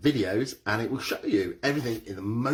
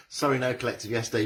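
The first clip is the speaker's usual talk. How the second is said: ordinary delivery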